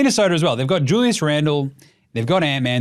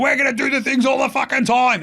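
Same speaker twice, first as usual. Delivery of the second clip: much higher, hammed-up eager young guys; excitable mock-youngster voice, pitched way up; young dudes; 9 semitones higher than usual. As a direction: pipe up as overkeen young players. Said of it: "And the young dudes are like".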